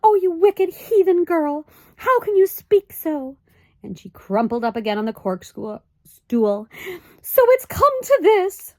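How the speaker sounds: high, loud, scandalized mother; outraged fussy mother; high indignant mother's cry; shocked Homily. A narrator's reading. Homily's voice in the story.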